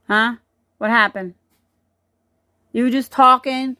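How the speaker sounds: ordinary delivery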